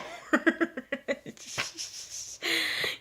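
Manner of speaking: soft, airy giggle; breathy laugh. Real, not acted. Telling viewers how she was corrected, with a soft giggle. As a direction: let out a quiet embarrassed laugh.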